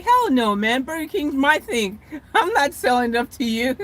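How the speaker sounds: defiant franchise owner. Retold or imitated. The Australian owner turning down Burger King, mock defiant. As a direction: play the cocky owner who won't sell.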